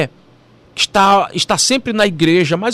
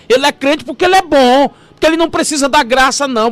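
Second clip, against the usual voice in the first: mocking sarcasm; 6 semitones higher than usual. Mocking the self-righteous.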